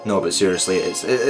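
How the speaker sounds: ordinary delivery, nothing marked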